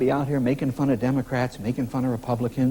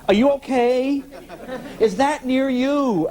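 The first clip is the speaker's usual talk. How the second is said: high, loud imitation of a fretting mom; mock-panicked mom checking on you by phone; worried mother; 12 semitones higher, 3 dB louder, slower than usual. Retold anxious phone call from mom.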